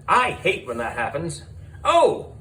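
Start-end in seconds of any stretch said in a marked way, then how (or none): 1.8-2.3 high surprised oh; sudden recollection. Real, not acted. Narrator suddenly remembering something to tell. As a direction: catch yourself remembering. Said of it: "I forgot to tell you something".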